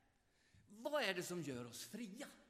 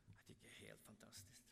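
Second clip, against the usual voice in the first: whisper; 11 semitones lower, 19 dB quieter, faster than usual. Real, not acted. Awed aside after praising the Lord.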